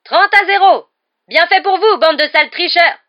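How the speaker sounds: very high, very loud partisan score call; gloating shout. A narrator's reading. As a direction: crow the score and taunt the cheats. Commentator jeers cheats after a goal. (cri vengeur de commentateur partisan)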